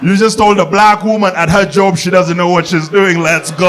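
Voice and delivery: stretched-out, delighted taunt; gleeful hype. Acted. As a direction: crow it like a hype man, ending on a long GO. Mock outrage, hyping the fight.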